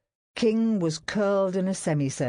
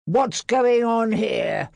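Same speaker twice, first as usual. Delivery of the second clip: slow, loud, higher demand; 7 semitones higher, 6 dB louder, slower than usual. A narrator's reading. Barrett confronting the crowd, demanding. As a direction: demand an answer. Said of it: "William Barrett demanded".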